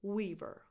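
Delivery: "Weaver" with a tense ee after the W; "Weaver", clear tense E in the first syllable. Shown as a demonstration. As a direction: say "Weaver" as WEE-ver. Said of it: "tense E after the W".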